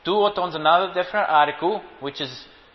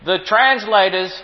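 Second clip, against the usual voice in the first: loud, driving preacher voice; preaching; 4 semitones higher, 6 dB louder than usual. Acted. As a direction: hammer it home to the congregation. Preaching at full force.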